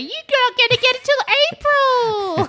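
high squawky magpie voice, laughing through it; mock bird squawk with a laugh. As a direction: play a taunting bird, way up high. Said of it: "the birds were like".

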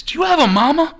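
high, loud nosy imitation; Tommy Rich. Tommy Rich asking the waiter about family, loud high imitation. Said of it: "He says".

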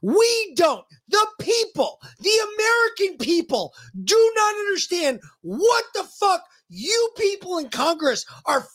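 high-pitched, indignant outburst; angry rant. Real, not acted. Host rants at a senator on behalf of the people, angry and high. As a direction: hammer it out, pitch pushed right up.

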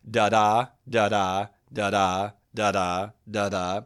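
each "da-da" weak then strong; five even "da-da" pairs, da-DA; iambic beat. Shown as a demonstration. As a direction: chant "da-da" stressed on the second syllable. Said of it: "first unstressed, second stressed".

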